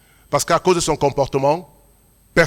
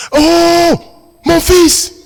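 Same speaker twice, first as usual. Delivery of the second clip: much higher, louder overjoyed exclamation; father's cry; 14 semitones higher, 9 dB louder, much slower than usual. Retold or imitated. Father recognising his grown son, crying out high and loud. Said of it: "Il fait".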